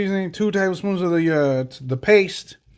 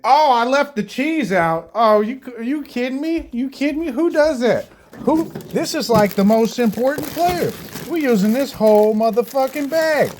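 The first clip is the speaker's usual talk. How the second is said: high, outraged are-you-kidding-me; raised voice, theatrical disbelief; indignant disbelief; 8 semitones higher than usual. Real, not acted. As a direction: scold yourself for forgetting the cheese. Cheese left out, mock outrage.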